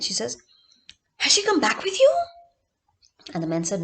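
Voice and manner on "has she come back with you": put-on high, loud, eager child's question; excited little girl. A narrator's reading. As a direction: blurt it out full of hope. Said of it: "the girl was very excited".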